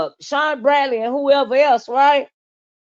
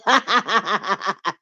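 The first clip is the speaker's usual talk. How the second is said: long rattling laugh; amused laugh; 4 semitones lower than usual. Real, not acted. Host cracking up at her own remark, amused laugh. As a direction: burst out laughing at your own joke.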